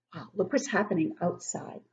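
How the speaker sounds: normal talking voice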